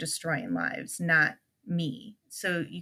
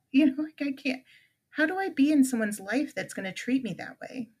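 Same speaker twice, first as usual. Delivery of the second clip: ordinary delivery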